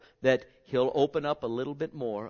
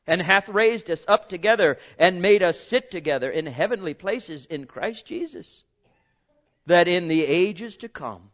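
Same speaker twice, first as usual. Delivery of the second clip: higher, louder ringing scripture; preaching scripture; 6 semitones higher, 11 dB louder than usual. Acted. Preacher reading Ephesians two to congregation, loud and high. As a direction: proclaim the verses at full force.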